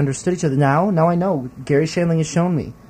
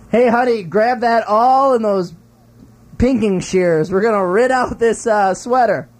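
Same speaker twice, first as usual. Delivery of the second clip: higher, louder calling to honey; acted homemaker line; 7 semitones higher, 3 dB louder than usual. Calling honey for the sewing tools, acted. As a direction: call out a made-up domestic line, pitched up. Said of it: "three sentences that they imagine, uh, the verb writ would be used in".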